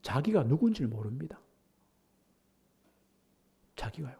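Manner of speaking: usual speaking voice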